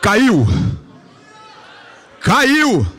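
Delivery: loud high shout. Real, not acted. Preacher answering the crowd, loud triumphant shout. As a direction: bark it out triumphantly.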